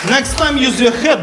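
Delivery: shouted mock scolding; half-joking parental yell. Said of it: "do like this".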